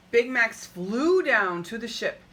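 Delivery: usual speaking voice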